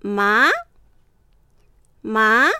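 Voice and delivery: teacher demonstrating ま in rising second tone; Mandarin second tone. Shown as a demonstration. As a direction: sweep ま upward like a question. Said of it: "最初は弱く、だんだん強く、勢いよく上げる".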